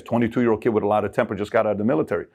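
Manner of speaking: ordinary talk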